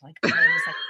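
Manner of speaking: very high shrieking squeal; panicked shriek. Retold or imitated. Panic in freefall.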